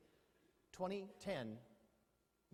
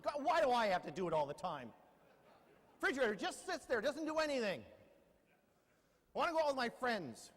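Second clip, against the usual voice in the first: put-on louder and higher, a put-upon teen complaining; whiny teenager rant; 6 semitones higher, 7 dB louder than usual. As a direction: whine it like a sulky teenager who hates chores. Appliance ranting like a sulky teen.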